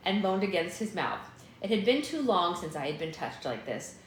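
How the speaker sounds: ordinary talk, nothing marked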